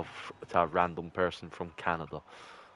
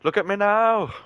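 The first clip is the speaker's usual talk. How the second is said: high mocking taunt, like a cartoon villain; 12 semitones higher, 6 dB louder than usual. Gamer gloats at the enemy he just killed with a high taunt. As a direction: squeak it out to gloat.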